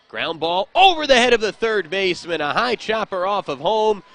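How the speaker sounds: loud, high excited call; excited play-by-play. Real, not acted. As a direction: call the hit with rising excitement. Play-by-play big hit.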